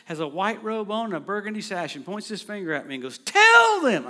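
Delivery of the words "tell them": much higher, much louder shout; Jesus screaming at the preacher; screamed command. Retold or imitated. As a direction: scream it, pointing straight at him. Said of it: "He screams at me".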